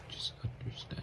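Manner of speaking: breathy whispered plea, soft and urgent; pleading whisper. Acted. Whispering to a friend, begging them to believe the story.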